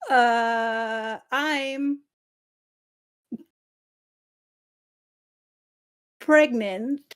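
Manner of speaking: low, halting admission with long pauses; stall, then let it out; hesitant confession. Acted. Pax tells Leonard her news, hesitant confession.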